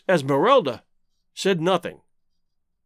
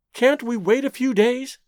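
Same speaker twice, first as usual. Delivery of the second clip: higher-pitched, pleading question; anxious plea; 6 semitones higher than usual. A narrator's reading. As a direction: plead gently for a delay. Nervous woman pleads for delay.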